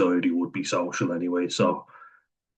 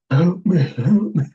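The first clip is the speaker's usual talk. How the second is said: much higher, louder, slow hammed-up cry for help; mock pitiful plea; 11 semitones higher, 7 dB louder, slower than usual. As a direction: play a pathetic patient begging the doctor, drawn out. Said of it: "It's like".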